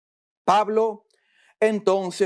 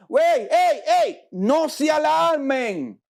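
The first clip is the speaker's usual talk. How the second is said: calling out; 7 semitones higher, slower than usual. Retold or imitated. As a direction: call out to calm them, a little slower. Pablo calls out to crowd.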